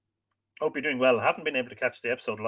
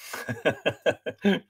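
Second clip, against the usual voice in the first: light run of chuckles; amused chuckle. Real, not acted. Host amused by a viewer's keyboard slip, amused chuckle. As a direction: chuckle to yourself.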